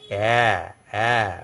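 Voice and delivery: "a" as a long open eh; flattened vowel. Shown as a demonstration. French teacher explaining grave accent on E, flattened vowel. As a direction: draw out "a" with lips spread flat. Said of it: "more flattened".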